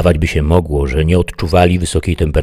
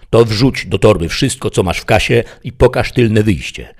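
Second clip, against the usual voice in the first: raised, brisk command; robber's order; 6 semitones higher, 4 dB louder than usual. A narrator's reading. Bandit orders the woman to fill the bag, commanding. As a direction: give orders to a hostage.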